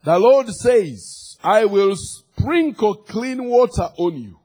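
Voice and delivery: loud, slow scripture proclamation; full-force preaching. Real, not acted. Preacher declaring the Lord's promise to congregation, forceful preaching.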